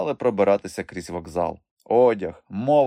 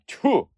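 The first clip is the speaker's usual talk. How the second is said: short, high spitting noise; scornful spit; 13 semitones higher, 4 dB louder than usual. A narrator's reading. Trader spitting at the fallen thief, scornful. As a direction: spit the syllable out in disgust. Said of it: "плюнув він на лежачого".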